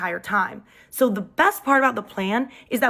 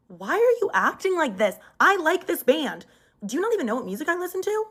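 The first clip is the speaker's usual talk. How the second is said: higher, indignant, faster at the end; mock offended; 7 semitones higher than usual. To her boyfriend, acting hurt and offended. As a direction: play the wronged girlfriend, rising up.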